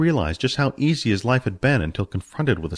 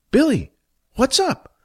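sudden much higher call of alarm; concerned call. Acted. Jack kneeling beside sick Billy, high worried call. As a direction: snap up high, worried for a friend.

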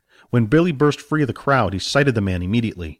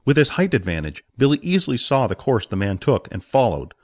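plain reading voice

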